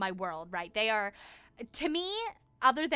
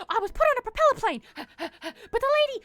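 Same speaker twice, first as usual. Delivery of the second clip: high, gasping words between heavy breaths; mock breathless running explanation; 12 semitones higher, 6 dB louder than usual. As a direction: pant it out while running. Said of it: "and I'm, like, running".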